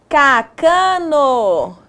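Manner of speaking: "Cacano" very slow, each syllable stretched; sounding out. Shown as a demonstration. Teacher testing made-up word.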